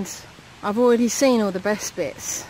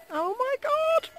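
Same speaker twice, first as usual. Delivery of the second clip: high, hushed oh my God; breathless at the sight; awed gasp; 4 dB quieter, slower than usual. Real, not acted. Hiker awed as horses come close, high hushed exclamation.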